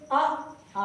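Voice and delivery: अ quick, आ stretched out; short a then long aa. Shown as a demonstration. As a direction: show the long आ against short अ. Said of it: "इसमें ज्यादा समय लग रहा है". (अ छोटा, आ लंबा)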